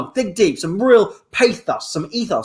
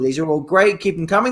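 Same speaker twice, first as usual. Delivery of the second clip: ordinary delivery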